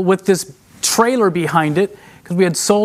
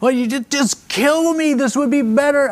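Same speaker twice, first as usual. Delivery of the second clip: a mild mock yell at God, climbing from near normal to much higher; imitating a desperate cry at God, mild at first, then much higher; retold yelling at God; 3 semitones higher than usual. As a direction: replay the outburst at God, pitch rising as it goes. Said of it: "I started yelling and screaming".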